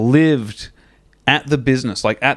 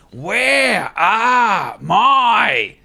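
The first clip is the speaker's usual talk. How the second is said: very slow, high, drawn-out mock bellow; angry demand; 8 semitones higher, much slower than usual. Wayno demanding his missing sandwiches, slow high mock yell. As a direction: stretch every word like an enraged foreman. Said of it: "then he said it again".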